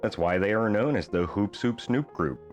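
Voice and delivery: usual speaking voice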